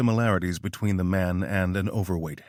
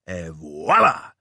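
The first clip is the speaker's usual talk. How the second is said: triumphant flourish; 3 semitones lower, 5 dB louder, slower than usual. Acted. Showman's reveal of the bullet.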